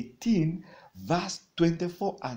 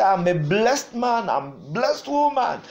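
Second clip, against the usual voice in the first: declaration; 5 semitones higher, 6 dB louder than usual. Shown as a demonstration.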